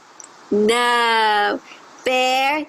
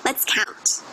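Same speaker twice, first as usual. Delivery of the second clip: put-on whispered aside to kids; whispering; 5 dB quieter than usual. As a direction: hush it like a secret. Whispered aside before counting.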